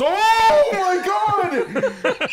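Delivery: long, high, loud mock-shocked shout; hammed-up scream of astonishment; shocked outcry. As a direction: stretch it out in scandalized disbelief. Acting out son's shocked friend.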